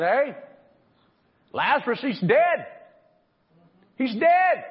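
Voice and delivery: high, rising panicked report; alarmed cry. Acted. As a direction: mourners frantically telling Jesus Lazarus died. Mourners announcing Lazarus's death.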